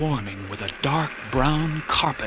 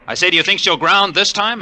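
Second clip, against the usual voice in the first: repeated louder; 5 semitones higher, 4 dB louder than usual. Acted. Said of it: "repeat louder".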